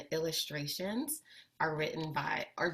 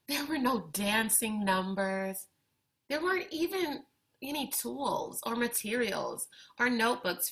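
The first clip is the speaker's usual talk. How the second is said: put-on expressive read-aloud for kids, lively and clear; picture book reading; 3 semitones higher, 4 dB louder than usual. A narrator's reading. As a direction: bring the story to life for young listeners. Reads picture book to children.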